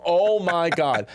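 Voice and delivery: slow, drawn-out mock disbelief; retold amazed reaction. Comic retelling his reaction to a shocking book, drawn-out amazement.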